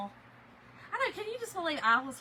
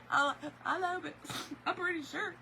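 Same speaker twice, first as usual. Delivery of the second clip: tearful laugh; 5 semitones higher than usual. Real, not acted. Laughing tearfully recalling stress.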